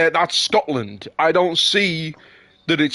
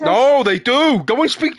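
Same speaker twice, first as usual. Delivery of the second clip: higher and louder, flat contradiction; insisting; 9 semitones higher, 4 dB louder than usual. Real, not acted. Contradicting his friend about Liverpudlians, loud insistence. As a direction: shut down his objection.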